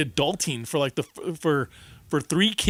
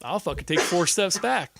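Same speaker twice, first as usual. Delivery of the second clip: put-on defiant kid taunt; 7 semitones higher than usual. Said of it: "she'll say".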